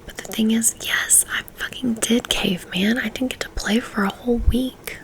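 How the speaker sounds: ordinary delivery, nothing marked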